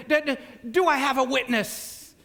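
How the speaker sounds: rising revival call for a witness; preacher's call. Pretend. Evangelist teacher calls on the class for a witness. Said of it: "role-playing as if an evangelist".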